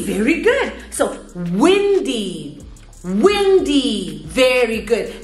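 put-on animated, drawn-out kids' lesson voice; teacher to young children. Shown as a demonstration. Teacher leading a weather game for children, animated. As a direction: sing out the words playfully to little learners.

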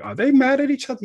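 high, loud, quick alarmed question; an onlooker startled by the heated debate; puzzled outsider. Retold or imitated. Onlooker wondering if debaters are angry, high surprised question. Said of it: "externally might be like".

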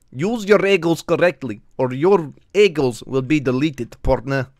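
silly Russian cowboy voice; slow, deliberate Russian cowboy accent. Shown as a demonstration. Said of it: "weird-ass fucking voice".

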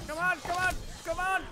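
high, loud, repeated urging; urgent shouting. Real, not acted. Player in mid-fight, urgent high shout. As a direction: shout them onward.

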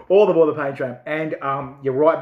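usual speaking voice

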